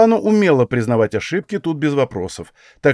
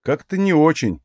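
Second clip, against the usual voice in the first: usual speaking voice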